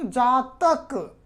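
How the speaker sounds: जातक stretched very slowly to show its ending; teacher sounding out जातक slowly; slowly sounded out. Shown as a demonstration. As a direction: drag out जातक so the अक suffix stands out. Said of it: "तो अक आ रहा है दोस्तों".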